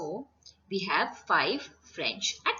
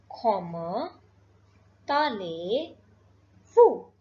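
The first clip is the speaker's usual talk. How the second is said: "Comment allez-vous" slow, broken into separate words; drawn out "Comment ... allez ... vous", long pauses between; word-by-word demonstration; 4 dB louder, much slower than usual. Shown as a demonstration. Teacher introducing the formal French greeting, word-by-word demonstration. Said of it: "we will say".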